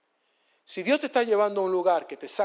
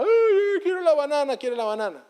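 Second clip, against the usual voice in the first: high, loud wailing plea that sinks to a whimper; whiny crying child; 8 semitones higher, 5 dB louder than usual. Retold or imitated. Little boy crying to his father for the banana, whiny wail. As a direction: play the little boy bawling for his banana, then winding down. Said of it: "Y el niño lloraba".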